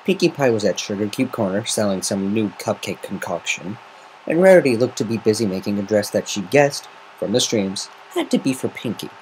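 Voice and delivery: usual speaking voice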